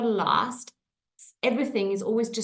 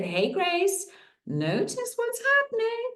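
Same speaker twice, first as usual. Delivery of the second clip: playful nudge; 12 semitones higher than usual. Retold or imitated. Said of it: "So I said".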